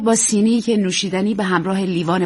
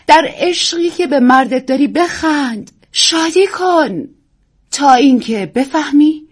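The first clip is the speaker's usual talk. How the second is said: higher, louder, slow and stagey; grand declaiming; 7 semitones higher, 5 dB louder, slower than usual. Acted. Actress lectures young wife on love, stagey declaiming.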